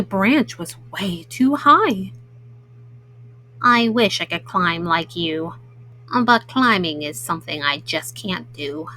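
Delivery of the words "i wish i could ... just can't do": low, downcast platypus voice; Gus. Acted. Platypus admitting to squirrel he can't climb, low and downcast. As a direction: sink the voice deep in disappointment.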